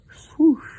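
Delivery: high, loud whooshing swoosh. A narrator's reading. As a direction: sweep it like a bird flying off. Bird flies off.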